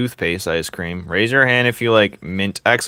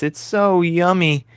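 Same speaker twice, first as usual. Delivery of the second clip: mock yummy; 10 semitones higher, slower than usual. Sarcastic praise of eating toothpaste.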